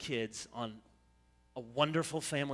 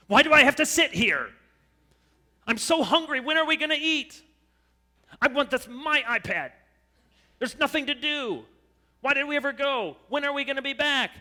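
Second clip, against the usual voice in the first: put-on high, loud childish whining; whiny kid; 11 semitones higher, 10 dB louder than usual. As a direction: complain like a bored child in the back seat. Kids whining on family trip.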